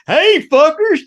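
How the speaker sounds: loud, high holler to the whole room; brash, attention-grabbing retold shout; re-enacted hollered greeting. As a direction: burst in and belt it out. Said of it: "I sling the fucking door open".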